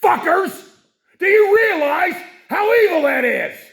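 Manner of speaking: sudden high, loud furious yell; furious outburst. Real, not acted. Host erupts at the evil he sees, furious shout. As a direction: explode at them in real rage.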